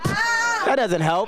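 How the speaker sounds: high, slow exasperated shout. Real, not acted. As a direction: yell it out, stretched and high.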